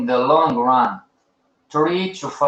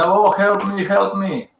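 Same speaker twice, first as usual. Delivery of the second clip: mock plea; 4 semitones higher than usual. Mocking crying traders pleading.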